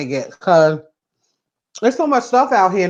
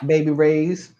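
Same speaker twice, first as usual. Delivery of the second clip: ordinary delivery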